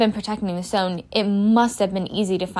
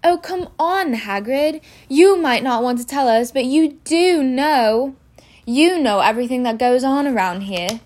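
put-on higher-pitched coaxing girl; warm, flattering; 5 semitones higher, 5 dB louder than usual. A narrator's reading. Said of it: "said Hermione in a warm, flattering voice".

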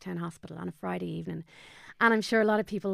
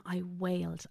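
ordinary talk, nothing marked

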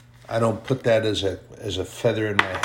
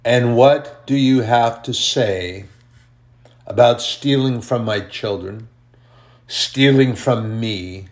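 God's booming voice; 6 dB louder than usual. Pretend. Preacher voicing God as a booming judge on his throne.

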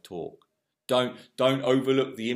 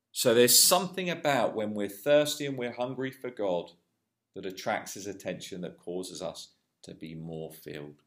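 usual speaking voice

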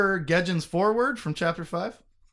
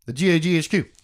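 tongue-twister rush, slightly louder; as fast as possible; 5 dB louder, faster than usual. Real, not acted. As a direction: rattle the letters off at top speed. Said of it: "say it as fast as I can".

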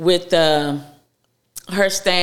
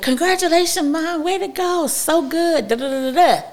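high sing-song imitation of gushing relatives; mock cheery congratulations; 11 semitones higher than usual. Relatives cheering mom on in the family chat, high sing-song. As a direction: gush like the whole family at once.

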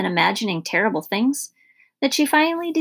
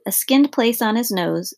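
plain reading voice

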